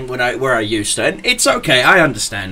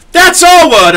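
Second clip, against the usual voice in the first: much higher and louder, mock offended; indignant retort; 8 dB louder than usual. Coworker claiming the word back, mock indignant. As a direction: bark it back like he owns the word. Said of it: "and he looks at me and goes".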